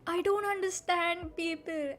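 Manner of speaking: ordinary talk, nothing marked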